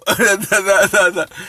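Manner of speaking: quick burst of laughter; real laugh. Real, not acted. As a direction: laugh out in a short run. Amused while reading comments.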